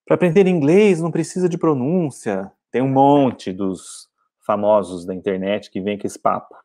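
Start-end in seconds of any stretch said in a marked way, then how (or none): 0.0-2.5 mocking quote.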